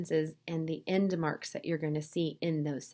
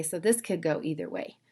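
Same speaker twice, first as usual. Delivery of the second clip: normal talking voice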